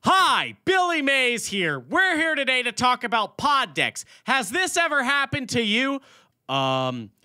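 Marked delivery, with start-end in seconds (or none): 0.0-6.0 loud, high pitchman voice; Billy Mays ad read. Acted. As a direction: shout it like an infomercial host. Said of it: "the Billy Mays ad read".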